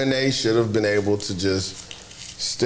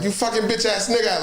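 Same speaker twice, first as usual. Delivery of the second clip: imitating Giannis cussing; 8 semitones higher than usual. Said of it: "hear Giannis cuss a motherfucker out".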